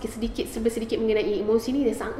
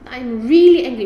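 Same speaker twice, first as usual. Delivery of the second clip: much louder, forceful declaration of anger; demonstrating angry; 11 dB louder, slower than usual. Retold or imitated. A mother showing her children her anger, loud and forceful. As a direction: show the kids what angry sounds like, loud.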